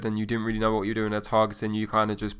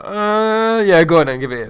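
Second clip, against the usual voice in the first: hammed-up reluctant surrender; friend giving in; 9 semitones higher, 11 dB louder than usual. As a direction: cave in to a mate's pushing. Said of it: "And he's like".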